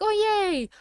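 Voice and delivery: a squeaky fake cheer, dripping sarcasm; sarcastic yay. Reacting to the sister tagging along, sarcastic high mock cheer. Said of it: "And I'm like".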